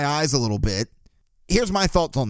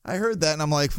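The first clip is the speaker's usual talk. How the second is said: usual speaking voice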